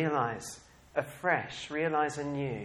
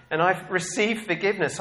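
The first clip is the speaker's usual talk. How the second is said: usual speaking voice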